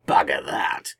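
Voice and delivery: low-pitched blunt exclamation; exclaimed. A narrator's reading. Tegan rejects keeping a reminder, exclaiming bluntly. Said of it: "Tegan exclaimed".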